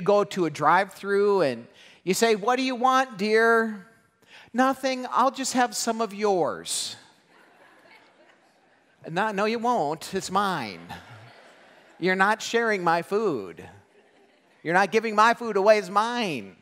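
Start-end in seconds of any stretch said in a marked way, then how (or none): 2.4-3.9 high, loud sweet offer to his wife; mock sweet husband. Husband sweetly offering his wife food at the drive-through. 4.5-7.0 high, coy wife reply, slowing down; mock wife voice. Wife telling husband she'll just eat his. Wife answering at drive-through. 9.1-16.6 loud, high protest over his food; guard the plate, hammed up; mock possessive husband. Husband loudly refusing to share his food with wife.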